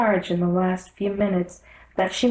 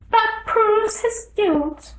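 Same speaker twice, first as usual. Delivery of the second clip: much higher, slow demanding queen; imperious queen; 3 dB louder, much slower than usual. Acted. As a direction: draw it out, high and haughty. The queen declares.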